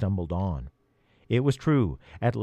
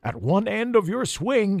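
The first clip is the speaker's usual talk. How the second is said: yelling; 10 semitones higher than usual. Acted. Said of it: "he yelled".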